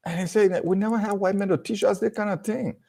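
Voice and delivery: lightly voiced child, pitched high; a hint of a child speaking; child's voice. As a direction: say it like an earnest kid. Retelling child's remark.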